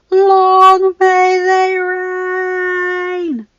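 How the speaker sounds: herald's proclamation. Acted. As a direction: proclaim the royal family to a vast crowd. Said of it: "channeled the spirit of the crowd".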